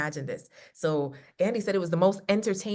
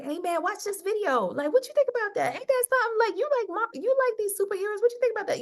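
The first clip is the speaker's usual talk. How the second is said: much higher, teasing sing-song voice; playful nudge; 3 dB louder, faster than usual. Pretend. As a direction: coax him like a buddy, high and bright. Wife acts nudging husband playfully.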